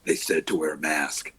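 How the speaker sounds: low gravel vigilante growl; Batman gravelly voice. Retold or imitated. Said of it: "best Batman gravelly voice".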